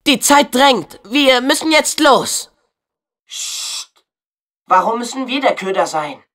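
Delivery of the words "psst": whispered psst; soft shushing hiss; hushing psst. Acted. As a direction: hush them quickly. Hushing the others.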